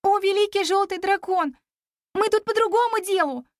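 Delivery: high, earnest appeal; exclaiming. A narrator's reading. Girl addressing the great dragon, exclaiming. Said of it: "воскликнула я".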